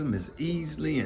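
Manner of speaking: ordinary delivery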